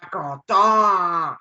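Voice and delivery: loud, slow, drawn-out sulk; mock sulky character. Gamer voicing his stuck avatar, loud slow sulk. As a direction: stretch it out like a pouting cartoon.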